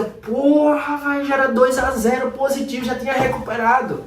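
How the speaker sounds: annoyed outburst. Retold or imitated. The trader cursing after missing a winning trade, exasperated. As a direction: blurt it out in frustration. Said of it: "Aí você fica".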